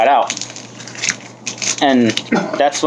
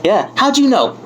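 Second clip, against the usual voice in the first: put-on girlfriend; 10 semitones higher than usual. Girlfriend voiced in retold scene.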